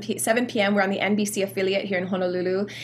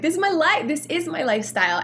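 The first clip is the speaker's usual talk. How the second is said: excited; 7 semitones higher than usual. Retold or imitated. Retelling her reaction to the category.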